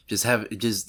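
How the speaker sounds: normal talking voice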